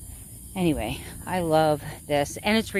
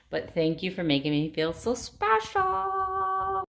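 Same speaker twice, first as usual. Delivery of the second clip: sincere thanks; 10 semitones higher than usual. Real, not acted. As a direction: thank them sweetly. Thanking the flower senders.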